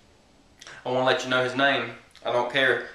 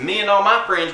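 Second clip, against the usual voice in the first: higher-pitched mock neighbor; Jarney; 7 semitones higher than usual. Neighbor complaining about the video, lightly mocked in higher voice. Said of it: "this is my impression of him".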